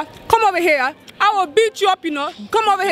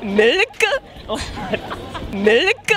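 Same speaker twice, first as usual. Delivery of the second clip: low, swooping upward Indian accent; 5 semitones lower than usual. Shown as a demonstration. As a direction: stretch it with a rising Indian lilt. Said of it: "say this in Indian".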